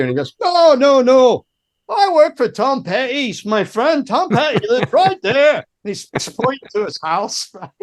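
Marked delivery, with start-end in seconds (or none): 0.4-5.6 put-on high-pitched, insistent Scottish accent; Scottish mate pleading his case, pitched well up; hard Scottish accent. Friend insisting to the guard, in hard Scottish accent. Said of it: "hard Scottish".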